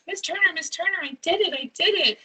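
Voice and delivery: put-on high, excited child calling out to the teacher; a proud little kid shouting his news. Child running across the classroom, calling out excitedly.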